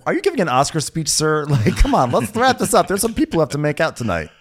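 high, loud, fast heckle; mock impatience. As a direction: hurry him offstage. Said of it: "I was like".

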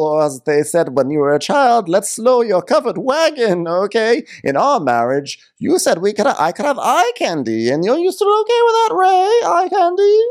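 high, theatrical indignant wife; Karen. Retold or imitated. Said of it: "She's like".

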